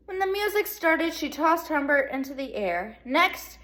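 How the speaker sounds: ordinary delivery, nothing marked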